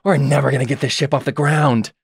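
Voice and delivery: mild grumbling from below; Geralt cursing. Acted. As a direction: grumble to yourself, a touch exasperated. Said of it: "we just hear Geralt just cursing".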